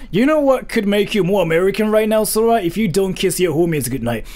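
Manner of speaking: American bro voice. Acted. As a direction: play the clueless American bro. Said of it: "that's what I think Americans sound like".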